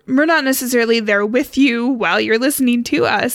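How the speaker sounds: normal talking voice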